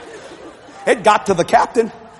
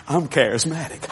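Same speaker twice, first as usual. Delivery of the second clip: hushed confession; 4 semitones lower, 6 dB quieter than usual. Retold or imitated. Captain's quiet confession.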